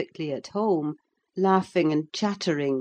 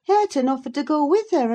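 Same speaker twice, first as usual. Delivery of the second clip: servant voice; 11 semitones higher, 5 dB louder, faster than usual. A narrator's reading. Servant voice line.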